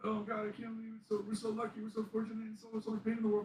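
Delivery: high-pitched, rushed weepy gushing; imitating tearful wife. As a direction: gush it out in a mock crying voice. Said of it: "doesn't see her crying".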